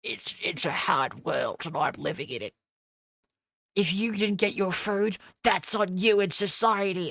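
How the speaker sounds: smug Redditor impression. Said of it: "Every Redditor that delivers Uber Eats is like".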